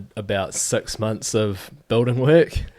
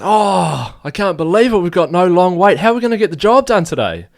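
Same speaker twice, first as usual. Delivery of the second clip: much higher and louder mock panic; hammed-up dismay; 9 semitones higher, 8 dB louder than usual. Builder Dave faking panic to fool the apprentice, high loud mock dismay. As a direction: play up the fake crisis of the missing tool. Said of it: "He goes".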